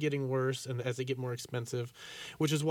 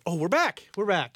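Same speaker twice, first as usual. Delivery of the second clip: upbeat return; 4 semitones higher, 6 dB louder than usual. Real, not acted. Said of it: "back on air".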